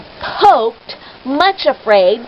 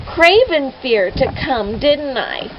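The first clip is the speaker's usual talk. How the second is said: plain reading voice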